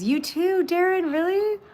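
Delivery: thrilled to find a fellow fan; excited. Real, not acted. Reacting to Darren also knowing the song, excited.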